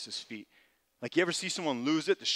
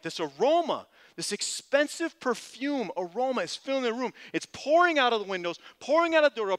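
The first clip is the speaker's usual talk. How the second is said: much higher and louder, building excitement; preaching at full force; 10 semitones higher, 9 dB louder than usual. Acted. Preacher to congregation, describing the crowd, loud and excited. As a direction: preach it big as the whole room reacts.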